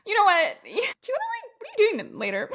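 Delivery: high giddy come-on, laughing through it; mock breathless high pickup; giddy flirt. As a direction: play the eager admirer. Acting the flirt role.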